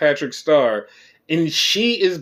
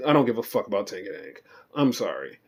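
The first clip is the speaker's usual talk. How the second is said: fast annoyed mutter; 7 semitones lower, 7 dB quieter, faster than usual. Real, not acted. Repeated annoyed aside.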